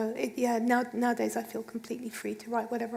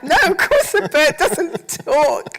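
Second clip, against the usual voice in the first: loud, higher, drawn-out protest; mock indignation; 5 semitones higher, 13 dB louder, slower than usual. Author answers publicist's bird question, loudly indignant. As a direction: scoff at an absurd question, played for laughs.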